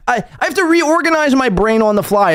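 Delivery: high-pitched mock complaint; mocking imitation. Mock quote of streamer's complaint.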